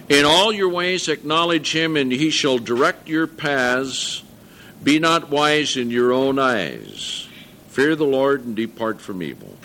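solemn Bible reading voice; scripture reading. Acted. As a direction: read it out with weight.

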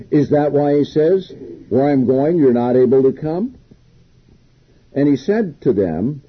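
reading the Bible passage aloud with weight; scripture reading. Acted. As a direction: proclaim the verse to the congregation.

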